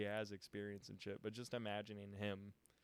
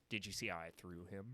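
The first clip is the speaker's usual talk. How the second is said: Zeke impression; 4 semitones lower, 4 dB quieter than usual. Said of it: "do my best impression of him".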